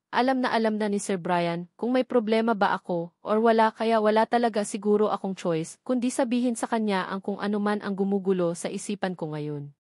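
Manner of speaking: usual speaking voice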